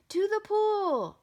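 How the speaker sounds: high, loud, stretched-out shout; yell. A narrator's reading. Boy echoing the firemen, high long yell. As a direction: yell it out, holding "pool". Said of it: "I yelled".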